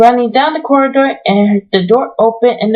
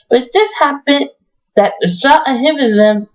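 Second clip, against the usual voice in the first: ordinary delivery, nothing marked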